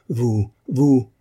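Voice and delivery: un vous, vous lancé plus haut et plus fort, à demi sanglotant; cri au bord des sanglots. A narrator's reading. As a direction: laisse monter un léger sanglot dans la voix. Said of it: "puis éclata en sanglots". (cri plus aigu et plus fort, avec un soupçon de sanglot)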